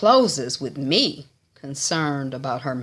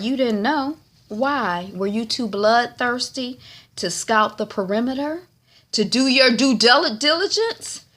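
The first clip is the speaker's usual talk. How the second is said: higher-pitched mock grilling; incredulous questioning; 7 semitones higher than usual. Father grilling his son over scouting, incredulous. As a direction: question each point in rising disbelief.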